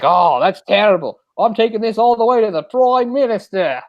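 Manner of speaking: a hammed-up Aussie vowing to take it to the top; imitating Australian accent. Aussie neighbor promising to escalate.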